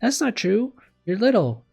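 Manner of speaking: put-on high, emphatic girl's voice; eager, high-pitched objection; earnest contradiction. A narrator's reading. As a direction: jump in high and loud to disagree. Girl contradicts her teacher's self-criticism.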